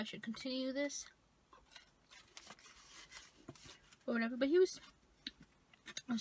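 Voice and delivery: fry touching lips; talking before a bite; speaking with fry at lips. Real, not acted. Seen 3: Holds curly fry while talking; raises it to lips from 4.8s.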